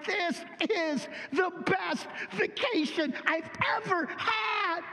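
high, slow, delighted cry of joy; blissful Lazarus. Pretend. Lazarus in paradise exclaiming in delight, high and slow. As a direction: gush like a man on the best holiday ever. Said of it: "He has been there four days going".

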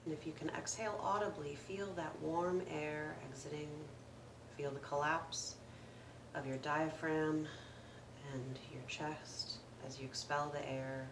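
calm meditation guide with long pauses; guided meditation. Acted. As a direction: gently lead listeners through the breath, unhurried, letting each phrase settle. Leads a breathing meditation.

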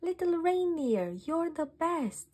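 high, loud cheer to the little hero. A narrator's reading. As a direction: celebrate him with a shout of joy. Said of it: "the team let out a cheer".

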